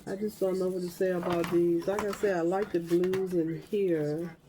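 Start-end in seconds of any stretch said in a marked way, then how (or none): none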